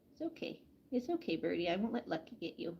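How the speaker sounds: quiet, calming words to a scared bird; hushed comfort for a frightened pet; soft reassurance. Real, not acted. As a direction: soothe the bird softly.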